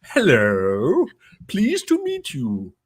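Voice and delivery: high, slow put-on proper accent; mock neutral accent, stiff and careful; posh non-Aussie voice. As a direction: hide the Aussie with a careful polite hello. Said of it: "every time I did".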